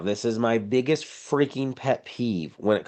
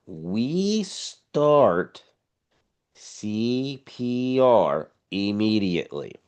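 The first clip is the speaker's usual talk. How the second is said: "we start C P R immediately" drawn out slowly, word by word; emphatic stress; much slower than usual. Real, not acted. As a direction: stretch each part of "C P R immediately" with pauses for emphasis. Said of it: "I will stress this again".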